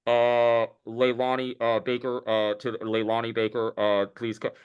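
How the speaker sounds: a light mock store intercom page, drawn-out uh's. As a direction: lightly imitate a dealership announcer paging the closer. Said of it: "they'll get on the intercom and they'll call in the closer".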